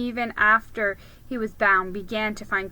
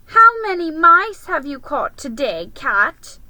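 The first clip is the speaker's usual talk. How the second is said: higher, louder sneering taunt; jeering taunt; 7 semitones higher, 9 dB louder than usual. Acted. Creatures taunting Aslan, higher and louder. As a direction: sneer it as a cruel joke. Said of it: "jeering at him".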